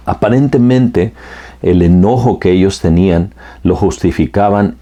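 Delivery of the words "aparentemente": ordinary talk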